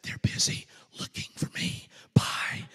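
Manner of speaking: put-on little boy's hushed whisper. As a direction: whisper secretly into the phone. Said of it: "came back on the phone whispering".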